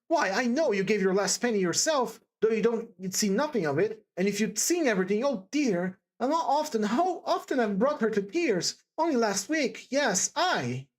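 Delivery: distressed, earnest character voice; agitated Sonia, remorseful. Acted. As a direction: blame yourself in emphatic bursts. Sonia reproaching herself.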